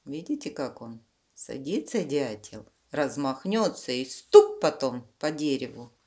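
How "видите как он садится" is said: usual speaking voice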